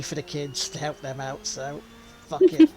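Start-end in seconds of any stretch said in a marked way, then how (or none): 2.3-2.7 sudden loud, high outburst; loud exclamation. Real, not acted. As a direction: blurt it out defiantly. Defiant punchline about cheating.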